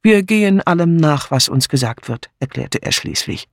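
usual speaking voice

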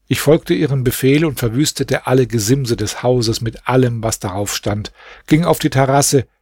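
ordinary delivery

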